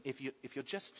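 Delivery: low, hushed temptation with long pauses; quiet, insinuating devil's offer; Satan tempting. Acted. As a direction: tempt softly, dropping low and letting each promise hang. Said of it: "he heard the words of Satan".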